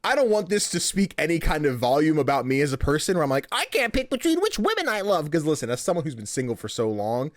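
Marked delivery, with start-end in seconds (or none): 3.5-5.3 high-pitched self-parody; mock lament. Single man mocking his romantic indecision, high mock lament. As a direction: pitch it up into a playful wail.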